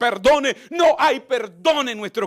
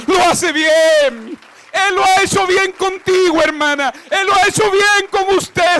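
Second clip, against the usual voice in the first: loud, rising sermon cry, repeating the refrain; impassioned shouted preaching; full-force preaching; 4 semitones higher, 8 dB louder than usual. Acted. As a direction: preach it out to the whole hall.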